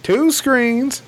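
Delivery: high-pitched astonished question; incredulous echo. Real, not acted. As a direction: repeat it back in sheer disbelief, pitch shooting up. Incredulous echo of surprising news.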